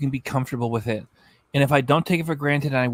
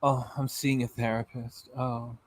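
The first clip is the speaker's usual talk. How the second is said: softer, mock-dramatic sigh of a line; 3 semitones lower, 8 dB quieter than usual. Vlogger parodies her therapy drama, softly. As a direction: ham up a dramatic confession. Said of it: "it'd be so dramatic".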